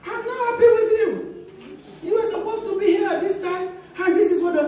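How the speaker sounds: high, fast, indignant scolding; retold scolding. Pastor's wife scolding late ministers, high fast indignant. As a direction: scold them fast and high, half laughing. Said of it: "I said".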